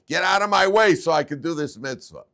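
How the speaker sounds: loud, high shove-aside shout; acted yell. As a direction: yell at someone blocking your way.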